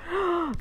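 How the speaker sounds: high, soft intake of breath; imitated shocked gasp. Ladies behind the counter hearing the story, imitated gasp. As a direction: gasp like the astonished listeners.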